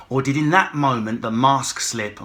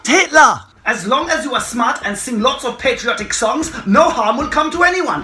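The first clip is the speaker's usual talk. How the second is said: higher, loud dictator rant; Hitler ranting; 9 semitones higher, 6 dB louder than usual. Pretend. Hitler impression.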